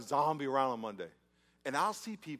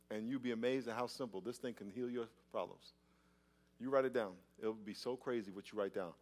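ordinary delivery

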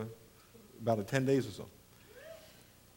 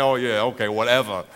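lower-pitched, unimpressed brush-off; dismissive; 7 semitones lower than usual. Retold or imitated. Comic recalling how he answered a boaster, dismissive. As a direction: wave them away, voice sinking. Acting out his own dismissive reply.

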